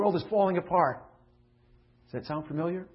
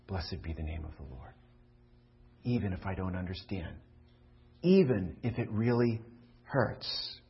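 much softer, lower, surrendering; humble submission; 8 semitones lower, 4 dB quieter than usual. Acted. Job yielding to God, hushed and humble. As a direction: give it up to God quietly. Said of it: "he should have responded like Job".

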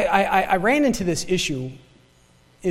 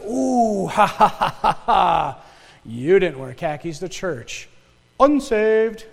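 mocking judgmental God; 4 dB louder, slower than usual. Preacher mocks a judgmental God.

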